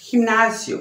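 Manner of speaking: "Gimnasio" with a throaty h-like rasp for the g; Spanish g sound. Shown as a demonstration. Teacher modelling "Gimnasio" for Portuguese speakers. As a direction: breathe the g of "Gimnasio" from the back of the throat. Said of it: "som mais parecido com o nosso R".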